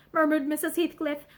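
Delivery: ordinary delivery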